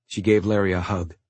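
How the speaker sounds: plain reading voice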